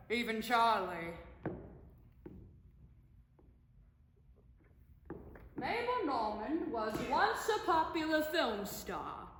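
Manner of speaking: put-on loud, showy old-Hollywood diva; big stagey voice, laughing at her own fame; brash silent film star. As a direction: play an indignant star demanding to be recognized.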